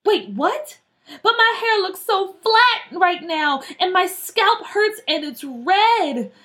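high, upset complaint; dismayed whining. Acted. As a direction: wail in dismay, dragging out the last words. Girl dismayed at damaged hair.

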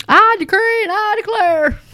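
hammed-up televangelist decree; scornful parody of a preacher's declaration; mock preacher decree. Mocking a prosperity preacher.

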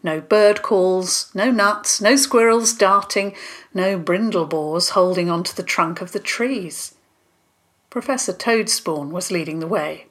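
ordinary delivery

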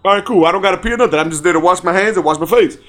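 usual speaking voice

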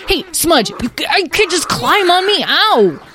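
put-on high, loud child in a scramble; holler, ending on a pained yelp; frantic yelling. Dragling shouts to Smudge while floundering in water, frantic.

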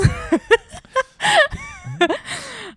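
long high cackling laugh; big wheezing laugh at own joke; corny dad laugh. Real, not acted. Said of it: "I'm laughing at my own joke".

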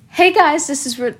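cracking voice mid-intro. Real, not acted. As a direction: voice cracks on the greeting. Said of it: "my voice cracked when I said that".

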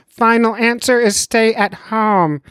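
high, slow know-it-all doctor; lightly voiced stern doctor. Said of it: "I'll do both voices".

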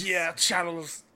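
hammed-up French name; mock French accent. Mocking the Frenchman's long name.

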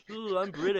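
exaggerated silly British accent; mock British accent. Podcaster shows how they talk, mock British accent. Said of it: "They talk like that".

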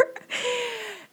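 high, breathy giggle; whispered laugh. Real, not acted.

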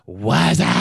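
loud high show-opening call; hyped greeting. Acted. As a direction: call it out big like a show host. Podcast opening hype greeting.

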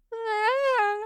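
high mock wailing cry. Sister mimicking how she wails when crying, high wail. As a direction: imitate her wail, rising high. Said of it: "and then it's just like".

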